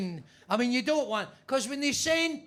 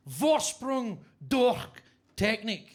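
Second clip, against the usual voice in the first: slow, drawn-out hammed-up German slogan; mock German accent; slower than usual. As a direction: stretch it out like a bad German advert. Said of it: "as they say in Germany".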